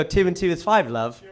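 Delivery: British accent. Shown as a demonstration. Comedian showing everything sounds right, British accent. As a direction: say it like a chummy Brit, British accent. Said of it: "everything sounds right in that accent".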